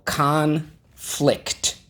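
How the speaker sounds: "Conflict" broken into two slow beats, first high; stress on first syllable. Shown as a demonstration. Teacher sounds out the new word for pupils, first-syllable stress. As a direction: draw out and lift the first half of "Conflict", then drop. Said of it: "the accent is on the first syllable".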